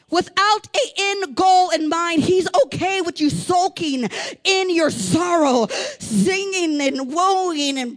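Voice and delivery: forceful revival cadence; fiery preaching. Real, not acted. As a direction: hammer out the list of self-pity.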